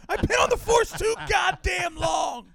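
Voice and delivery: shouted action-movie cop line; tough cop shouting. Pretend. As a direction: bark it like a gritty cop.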